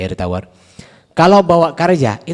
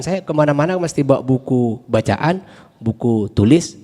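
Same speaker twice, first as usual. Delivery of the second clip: normal talking voice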